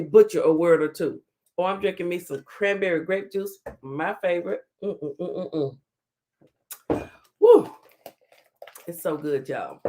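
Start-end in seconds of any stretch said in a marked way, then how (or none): 4.8-5.8 savoring hum. Real, not acted. Savoring her favorite juice. 7.4-7.7 high, loud whoop of delight. Real, not acted. Reacting to tasty juice.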